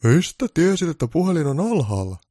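Santa's puzzled question; suspicious Santa. Acted. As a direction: play Santa wary of the caller. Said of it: "Pukki kysyi".

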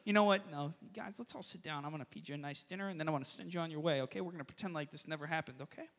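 a calm host talking down an army; soft gracious voice. Retold or imitated. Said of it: "annihilate all of them".

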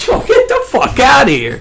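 high laughing yell of disbelief; half-laughing yell; laughing shout. Retold or imitated.

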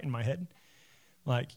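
usual speaking voice

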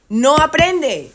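drawn-out, weighted NO APRENDE; slow and heavy on "no aprende"; emphatic stress. Real, not acted. Stressing the consequence in an argument. (énfasis lento y marcado en "no aprende")

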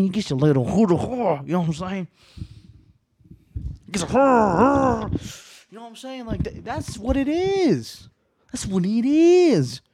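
loud, high, worked-up rant; riled up. Real, not acted. To podcast listeners, riled up about a friend's constant disses. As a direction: let the anger boil over, half laughing. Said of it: "I'm riled up".

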